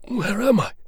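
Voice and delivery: weak soldier asking the doctor; wounded soldier. Acted. Arthur asks location.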